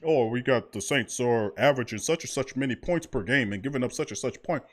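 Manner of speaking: lightly voiced pundit droning stats; a hint of a TV analyst reading numbers; mock commentator. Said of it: "sit up there and pretend".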